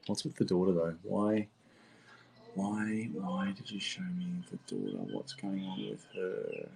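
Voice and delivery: very soft, as if thinking aloud; murmured questioning. Real, not acted. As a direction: ask the spirits under your breath. Questioning spirits under the breath.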